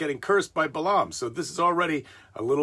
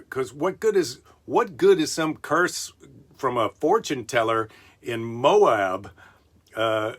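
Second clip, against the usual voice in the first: usual speaking voice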